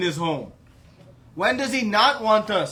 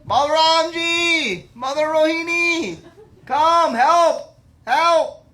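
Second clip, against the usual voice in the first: high, drawn-out cry for help; yelling out; 11 semitones higher, 8 dB louder, slower than usual. Acted. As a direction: shout urgently to someone far away. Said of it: "he's really yelling out".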